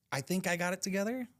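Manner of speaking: normal talking voice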